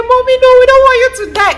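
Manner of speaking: put-on begging kids. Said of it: "they start begging me".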